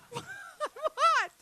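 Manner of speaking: very high, loud startled squeak; squeaky what. Real, not acted. Comic reacting to the audience, high squeak. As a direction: yelp it up high.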